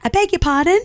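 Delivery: high, prompting mock-polite query; strict mum. Mum correcting her daughter, high prim query. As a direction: imitate a mother fishing for manners. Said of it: "And Kelsey was like".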